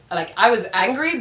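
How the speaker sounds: ordinary talk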